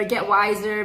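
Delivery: ordinary delivery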